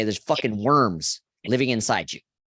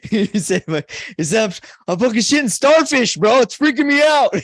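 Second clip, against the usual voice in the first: much higher, louder panicked buddy voice; freaked-out friend; 11 semitones higher, 8 dB louder than usual. Retold or imitated. Retelling the friend's bathroom panic, high and loud. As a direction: squeal it like a scared friend. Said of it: "he was freaked out".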